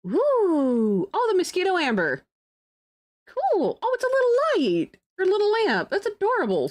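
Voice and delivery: very high, gushing excitement; delighted squeal. Real, not acted. Streamer finds a little amber lamp, delighted squeal. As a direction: coo over it way up high.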